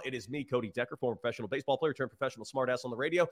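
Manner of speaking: ordinary talk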